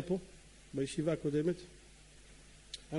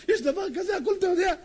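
high, loud tearful cry; acted sobbing insistence; wailing plea; 11 semitones higher, 6 dB louder than usual. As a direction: plead desperately in a hammed-up wail. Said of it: "כולו בוכה".

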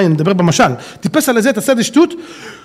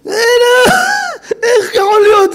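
shocked outcry; 13 semitones higher than usual. Acted. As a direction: cry out as if it cannot be. Acted outrage at the idea.

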